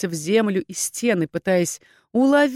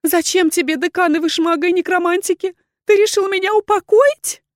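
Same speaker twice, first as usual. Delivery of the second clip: high, panicky voice rising; frightened woman, shrill with dread; terrified questioning; 11 semitones higher, 5 dB louder than usual. A narrator's reading. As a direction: demand answers in mounting panic. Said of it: "испуганно зашептала Валерита".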